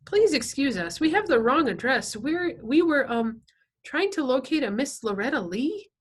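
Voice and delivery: nervous, polite Freak. A narrator's reading. Freak excusing himself at the wrong address, nervously polite. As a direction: apologize carefully in a high boyish voice.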